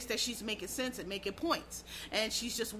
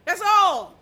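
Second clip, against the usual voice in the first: loud, higher punchline yell; real shout; 6 semitones higher, 12 dB louder than usual. Real, not acted. Closing his rant against debating fools with a loud shout. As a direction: blast it out to slam the point shut.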